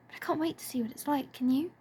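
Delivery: put-on a girl perking up as she asks. A narrator's reading. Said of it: "cheering up".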